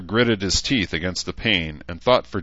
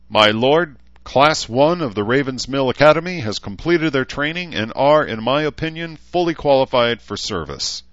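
higher, louder parade-ground announcement; formal military report; 7 semitones higher, 5 dB louder than usual. Acted. Gunny reporting the trained class to Mayor Talbot, formal and loud. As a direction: report loudly and formally to a superior.

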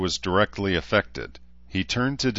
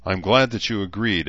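plain reading voice

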